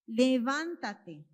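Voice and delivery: loud, drawn-out command. Acted. Jesus telling the little girl to get up, loud slow command. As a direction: command it firmly, stretching the word.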